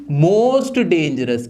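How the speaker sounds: slow, stressed "Most" with a deep, drawn-out "dangerous"; stressed answer. Shown as a demonstration. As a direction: lean hard on "Most" and drag it out. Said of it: "वो क्या हो जाएगी dangerous की?". ("Most" पर ज़ोर, बहुत धीमे)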